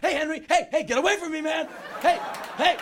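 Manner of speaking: loud high yelling; shouting fans. Retold or imitated. Fans jumping on him, yelling his name. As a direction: yell it over a crowd.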